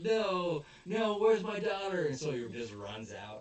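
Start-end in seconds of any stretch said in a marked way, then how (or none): 0.0-2.0 much higher, louder panicked yell; hammed-up worried father; mock desperate cry; frantic shout. Father searching for daughter.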